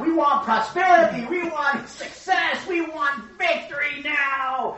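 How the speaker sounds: high, loud, drawn-out chant-like shout; clamor like a crowd demanding its prize. Acted. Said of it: "All these people are like".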